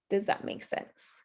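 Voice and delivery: whispered, hesitant question; unsure whisper. Shown as a demonstration. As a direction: breathe it out, unsure. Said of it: "instead of asking".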